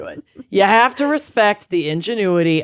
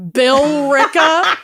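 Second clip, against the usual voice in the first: laughing disbelief; 8 semitones higher, 4 dB louder, slower than usual. Real, not acted.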